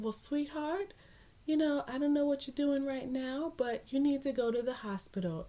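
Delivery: gently urge, imitating a sweet nurse. Nurse telling her to go to hospital, sweetly. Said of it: "the doctor is being as sweet as can be".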